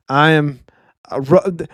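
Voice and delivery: ordinary talk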